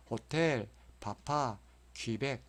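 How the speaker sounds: 호텔, 바파, 퀴벡 stressed on their final syllables; weight on 텔, 파, 벡 in 호텔, 바파, 퀴벡; back stress. Shown as a demonstration. Teacher recapping three exceptions, end stress on 호텔, 바파, 퀴벡. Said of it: "뒤에 강세가 있는 것이".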